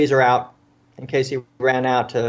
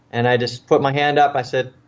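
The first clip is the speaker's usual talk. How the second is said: ordinary delivery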